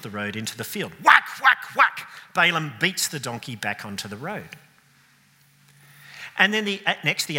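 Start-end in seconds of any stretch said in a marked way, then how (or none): 1.0-2.1 loud, high mock whacks; whacking sound. Preacher acting Balaam beating the donkey, loud whacks. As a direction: smack out each whack like a stick blow.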